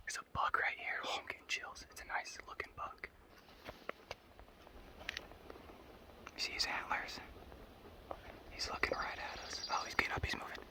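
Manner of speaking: hushed whisper so the buck won't spook; breathless whisper, thrilled by the buck; wildlife watching whisper. Real, not acted.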